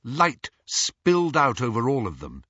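usual speaking voice

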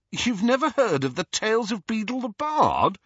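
high-pitched disbelief, rising at the end; incredulous. A narrator's reading. Ron to Hermione about the storybook, incredulous. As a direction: ask it as if you can't believe it. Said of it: "said Ron incredulously".

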